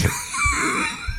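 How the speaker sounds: squealing burst of laughter; high shrieking laugh. Real, not acted. Breaks up again.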